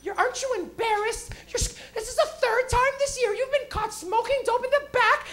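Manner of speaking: high, scolding principal voice; imitated principal. Principal scolding student caught smoking, very high-pitched. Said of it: "my principal used to come to me".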